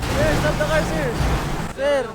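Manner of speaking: high, loud yell across a distance; loud desperate call after the car; shouting after a car. Acted. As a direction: bellow to make the driver stop.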